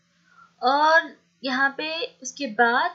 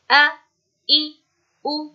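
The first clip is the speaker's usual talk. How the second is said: short and quick; 3 semitones higher, 8 dB louder, much slower than usual. Shown as a demonstration. Teacher showing pupils how to read fatha, kasra, damma, short and quick. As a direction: say आ, ई, उ as short, separate vowels. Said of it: "ऐसे जल्दी पढ़ना है".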